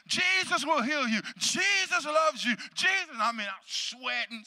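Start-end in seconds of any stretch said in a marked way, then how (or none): 0.1-3.2 high, impassioned crusade preacher; re-enacted fervent preaching. Preacher re-enacting his sermon in Africa, fervent preaching. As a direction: preach it out high to a festival crowd. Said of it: "I was preaching".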